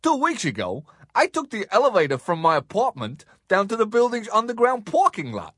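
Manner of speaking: exaggerated American accent with jumpy, rising pitch; hammed-up, badly faked American. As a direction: attempt an American accent and overdo it. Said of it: "for us to practice American accents with".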